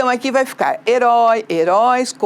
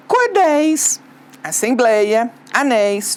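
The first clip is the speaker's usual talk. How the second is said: ordinary talk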